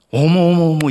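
high, loud, gushing; mock delighted gasp. Pastor acting the reaction he did not give, mock gushing. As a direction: gush like you're amazed. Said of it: "이러지 않았어요".

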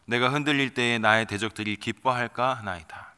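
solemn, measured psalm reading; scripture reading. Acted. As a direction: read the prayer as holy text. Reads psalm verses 3-4.

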